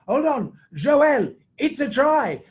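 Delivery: high, loud mock proclamation; Didier Mene. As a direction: imitate a referee calling out his decision. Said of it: "He goes".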